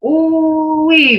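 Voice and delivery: Ooh-wee high and falling. Shown as a demonstration. Teacher repeating the ooh-wee tone for the class. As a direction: sing out Ooh-wee high then drop. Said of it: "Hear that tone?".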